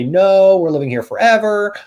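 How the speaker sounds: loud, high emphatic no from a homeowner; a homeowner shutting it down, loud and high; homeowner's firm no. Retold or imitated. Homeowner refusing the agent's offer, loud and high. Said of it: "They'll say".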